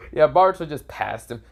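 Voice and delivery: usual speaking voice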